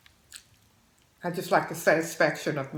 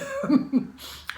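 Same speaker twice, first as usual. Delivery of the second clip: high burst of laughter. Real, not acted. Laughs after recalling fun.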